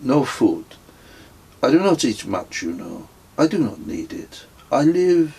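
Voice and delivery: put-on wandering pilgrim refusing food, strong French accent. A narrator's reading. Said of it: "in a strong French accent".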